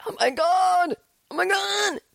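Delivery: hammed-up fangirl freakout; starstruck squeal. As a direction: squeal like a fan meeting her hero. Reacting to idol's coffee invite.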